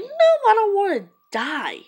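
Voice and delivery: very high, loud exclamation falling away; high-pitched protest. Retold or imitated. Answering the shooter's absurd threat, high-pitched protest. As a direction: burst out in a high, incredulous protest.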